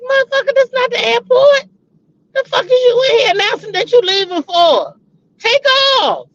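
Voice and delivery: high-pitched, indignant clapback; mock-outraged retelling of a comeback; indignant retort. Retort to a departing follower.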